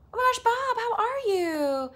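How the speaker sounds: high, loud gushing hello; delighted greeting. Real, not acted. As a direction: greet a long-missed friend with a squeal. Greets familiar viewer with delight.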